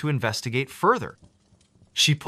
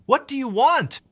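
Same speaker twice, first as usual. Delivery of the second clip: high, louder desperate cry; frightened cry; 12 semitones higher, 4 dB louder than usual. A narrator's reading. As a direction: shout into the phone in fear. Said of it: "she cried".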